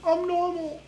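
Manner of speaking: very high, soft mock whimper; mock crying. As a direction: whimper it like a crybaby. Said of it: "they're gonna start crying".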